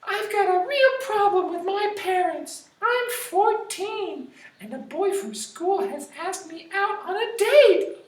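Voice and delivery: put-on very high, girly teen voice; teen girl voice. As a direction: play a fretful teenage girl. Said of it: "I'm gonna do my best to sound like a teenager".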